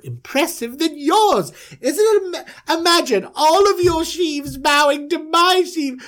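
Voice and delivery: high, giddy self-importance; gloating dreamer. Acted. Manager invites brothers to interpret his dream, high and gloating. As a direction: revel in it, voice way up.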